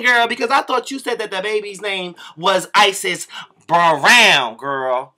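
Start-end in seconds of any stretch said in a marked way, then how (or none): none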